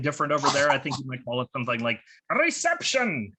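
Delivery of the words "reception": mock British accent on reception; a hammed-up bad British accent; comic's bad British accent to the crowd. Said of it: "one bad British accent for me".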